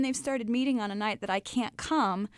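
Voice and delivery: usual speaking voice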